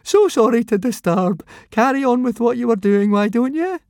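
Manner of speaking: high, fake-breezy nervous voice; forced airy. A narrator's reading. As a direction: pretend to be casual, badly. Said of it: "He tried to laugh in an airy fashion".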